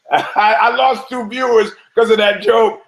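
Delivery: high, talking through laughter; tickled by your own bad joke; real laughter all through; laughing hard. Real, not acted.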